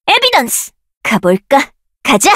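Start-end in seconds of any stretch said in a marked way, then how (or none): 0.1-0.7 much higher, ringing out; high shouted spell name. Acted. Caster shouting the spell name high.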